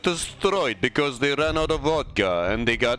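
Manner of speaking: light put-on Russian accent. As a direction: play it as a Russian caster. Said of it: "That's my Russian accent".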